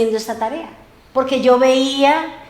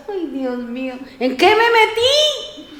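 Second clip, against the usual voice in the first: dismayed sigh; 6 semitones higher, slower than usual. Retold or imitated. Retelling her dismay at ironing.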